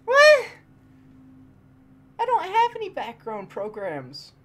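very high, loud disbelieving shriek; outraged disbelief at the lag; shocked yell. Real, not acted. Gamer shrieking at sudden lag, shocked and loud.

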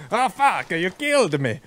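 high, hammed-up Italian accent; mock Italian accent. Killed enemy complaining to the player, mock Italian accent. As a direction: play a dying enemy with a cartoon Italian accent. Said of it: "I don't know why they're Italian".

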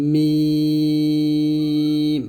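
"মিম" light, with a very long "ee"; thin long Mim. Shown as a demonstration. Teacher demonstrating mim with lips together, thin and prolonged. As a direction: hold "মিম" out, closing the lips. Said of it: "পাতলা হবে".

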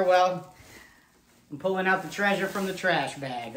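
ordinary talk, nothing marked

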